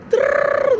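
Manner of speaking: a sharp, high, breathy rolled "trrr"; rolled trill noise. Retold or imitated. Telling her story, she makes a high rolled trill noise. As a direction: trill it out like a rattle. Imitated noise after the list.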